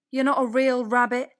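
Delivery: loud, jeering taunt; mocking taunt. A narrator's reading. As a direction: rabbits sneering at the odd one. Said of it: "they said".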